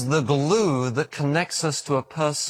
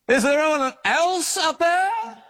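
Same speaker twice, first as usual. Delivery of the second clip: high, slow hopeful shout; shouted again; 13 semitones higher than usual. Acted. Hanging man shouts up asking for someone else, hopeful. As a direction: yell up again, looking for another answer. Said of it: "the man shouted again".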